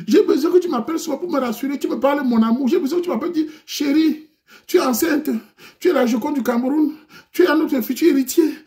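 very high, loud tearful whine; high falsetto plea, half crying; whiny pleading girlfriend. Acted. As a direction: beg like a hurt girlfriend on the verge of tears.

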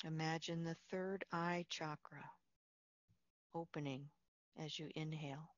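soft, unhurried meditation voice with long pauses; guided meditation. Real, not acted. Leads a Yoga Nidra relaxation.

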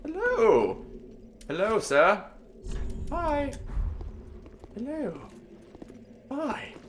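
little high-pitched hello voice; coy high greeting. Pretend. Player greeting a game character, high playful voice.

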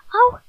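hoog luid au; au van pijn. Acted. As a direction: een plotse pijnkreet. Bekogeld met noten. (Erik krijgt een noot op zijn kop: hoge pijnkreet)

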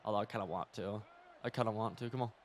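normal talking voice

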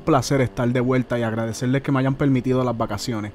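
brisk promo read over music; upbeat announcer plug to viewers. Acted. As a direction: pitch the channel like a sponsor spot. Host breaks in with a channel plug.

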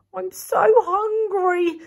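a slow hungry bear moan; lightly voiced hungry bear. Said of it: "said Gary".

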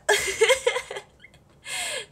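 high loud burst of laughter; real hearty laugh. Real, not acted. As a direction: crack up out loud. Laughing at the funny filter.

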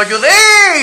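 high, loud call across the grass. Retold or imitated. Husband calling his wife in the park, loud and high. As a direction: call her name from a distance.

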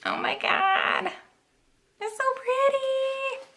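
squeaky, awed gush over something pretty; soft high-pitched swoon. Real, not acted. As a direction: breathe it out high and delighted. Gushing over a pretty mask.

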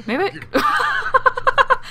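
breaking into high giggles mid-sentence; words lost in a burst of laughter; laughing. Real, not acted. Player cracking up over her Sim's name slip, laughing. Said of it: "I'm sorry I'm laughing".

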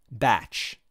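usual speaking voice

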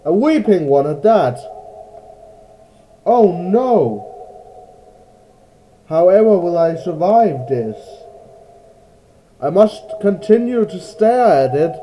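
loud, high mock panic; hammed-up terror. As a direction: overact being terrified. Jokey fear at weeping angel.

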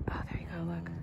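hushed whisper so as not to disturb; soft unvoiced whisper at the birth. Real, not acted. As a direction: breathe it out, barely voiced. Watching cow give birth quietly.

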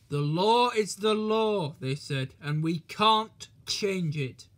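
loud, slow, firm recital; stern policemen. Acted. Policemen refuse the fat controller, firm and slow.

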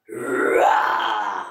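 low, loud drawn-out roar; lion roar. Pretend. Reader imitating a lion for children, loud roar. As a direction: let out a long, deep roar.

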